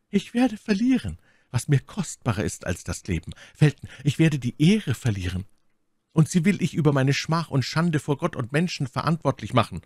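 lightly acted desperate lady; My Lady, verzweifelt anklagend. A narrator's reading. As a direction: warn him of lost honour, pitch lifting. (leicht gespielte, anklagende Lady)

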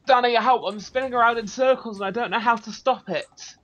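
high-pitched mock panic; hammed-up helpless wailing to a friend; panicked call for help. Calls friend for help in game.

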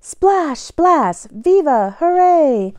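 usual speaking voice

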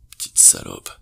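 low, menacing hidden voice; a cold secret thought; the husband's true voice sneering; sinister inner voice. A narrator's reading. Sinister hidden voice through AirPods.

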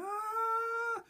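high mock fangirl scream; girls screaming. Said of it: "the girls would start screaming".

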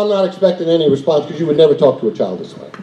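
usual speaking voice

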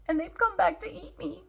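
high-pitched story-time fear; frightened. A narrator's reading. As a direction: squeak it like scared prey. Sam frightened of returning tigers.

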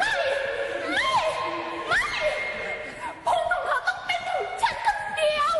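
very high, laughing wail of possession; shrill melodramatic wail. Acted. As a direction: shriek it like a soap-opera rival, laughing. Dubbing catfight climax.

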